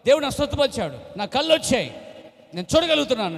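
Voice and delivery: high, loud joyful insisting; insistent shout. Retold or imitated. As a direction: shout it so everyone hears. Telling family loudly. (కళ్ళు వచ్చాయని గట్టిగా అరుపు)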